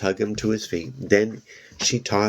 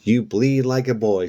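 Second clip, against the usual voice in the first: playful threat; 4 semitones higher, 3 dB louder than usual. A narrator's reading. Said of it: "she threatened".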